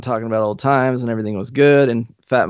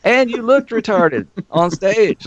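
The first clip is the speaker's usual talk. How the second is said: sarcastic agreement; 10 semitones higher, slower than usual. Retold or imitated. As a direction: rub it in with a high sneer. Mocking reply to bandmate.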